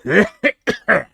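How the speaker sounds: loud, high hacking cough; coughing fit. Acted. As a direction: cough hard before reporting back. Guard returns from search.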